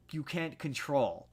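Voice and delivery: normal talking voice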